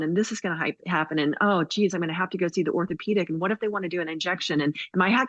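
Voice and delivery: quick run-on acted fretting; fast, lightly anxious inner monologue; spinning worried thoughts. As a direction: rattle off worries, one tumbling into the next. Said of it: "we start spinning with all of these thoughts".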